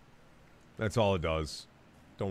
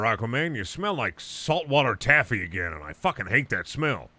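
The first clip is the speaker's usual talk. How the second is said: ordinary talk, nothing marked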